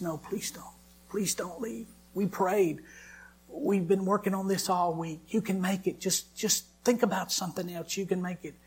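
pleading wife. Retold or imitated.

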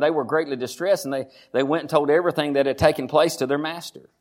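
usual speaking voice

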